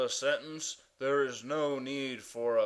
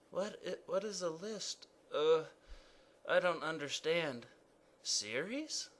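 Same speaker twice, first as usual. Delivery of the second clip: puzzled question mark; 7 dB quieter than usual. Acted. Question mark is confused by the king's words, softly puzzled. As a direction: ask as if lost.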